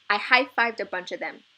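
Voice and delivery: ordinary delivery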